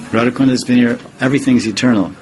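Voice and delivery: usual speaking voice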